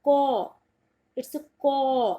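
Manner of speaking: British "कॉ", silent r, p and s, only the open vowel; British English demo of "कॉ"; British pronunciation. Shown as a demonstration. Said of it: "और अगर कोई British इसको बोलता है".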